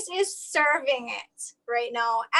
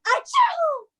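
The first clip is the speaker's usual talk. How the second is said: loud high fake achoo; fake sneeze; 4 dB louder than usual. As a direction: belt out a hammy sneeze. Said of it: "Get it?".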